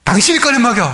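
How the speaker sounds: a half-acted angry retort to her husband; retorting wife. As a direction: fire it back at him in mock anger. Wife snaps back in quarrel.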